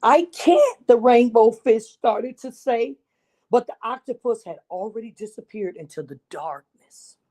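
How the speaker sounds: put-on lively read-aloud for children; storybook narration. A narrator's reading. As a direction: tell it like a picture book to a class. Reads picture book to children.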